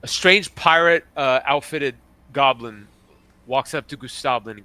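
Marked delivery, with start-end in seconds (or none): none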